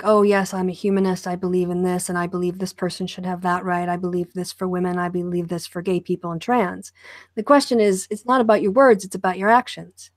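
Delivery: ordinary talk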